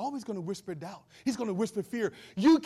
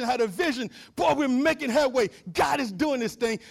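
preaching at full force; 4 semitones higher, 7 dB louder than usual. Real, not acted.

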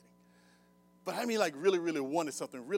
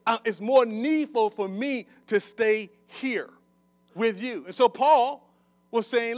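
raised, loud sermon delivery with punched key words; forceful preaching; 7 semitones higher, 10 dB louder than usual. Real, not acted. Preacher quoting Paul to the congregation, forceful. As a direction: preach it out to the whole room.

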